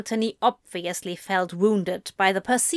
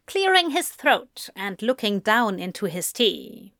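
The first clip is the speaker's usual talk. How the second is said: ordinary delivery, nothing marked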